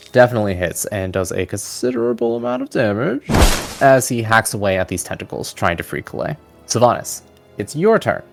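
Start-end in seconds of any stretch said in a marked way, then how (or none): none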